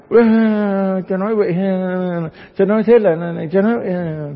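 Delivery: huffy churchgoer snapping back sarcastically; indignant parishioner. Acted. Priest voices indignant parishioner.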